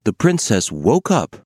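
ordinary delivery

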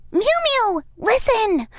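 ordinary delivery, nothing marked

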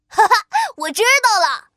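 loud eureka laugh; delighted laugh. Acted. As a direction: laugh with a sudden idea. Qiqi gets an idea.

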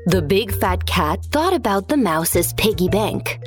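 plain reading voice

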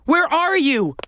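calling out. Acted. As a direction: call out to someone hidden. Calling to the trapped tortoise.

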